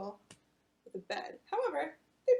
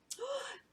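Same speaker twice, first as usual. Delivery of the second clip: delighted gasp; 13 semitones higher, 4 dB quieter than usual. Acted. Reunion moment.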